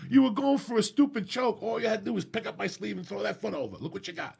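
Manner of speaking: high, loud mock correction from a training partner; excited coaching. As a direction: exclaim it like a teammate pointing out the missed move.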